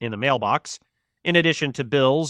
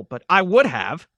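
raised voice, pitched higher; mild shout; 5 semitones higher, 4 dB louder than usual. A narrator's reading. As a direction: raise the voice for eavesdroppers to hear. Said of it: "he shouted, in case someone".

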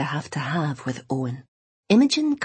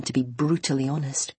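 usual speaking voice

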